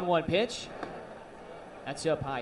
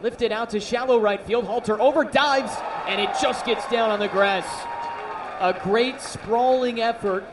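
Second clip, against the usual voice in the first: raised, louder live call; rising baseball commentary excitement; excited play call; 5 semitones higher, 4 dB louder than usual. Real, not acted. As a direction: call the diving catch attempt as it happens.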